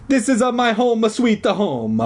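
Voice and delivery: high, indignant stage-Italian; angry Italian accent. A narrator's reading. Janitor defending his home from kids, angry Italian accent. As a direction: protest in a hammed-up Italian accent. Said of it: "the man said angrily".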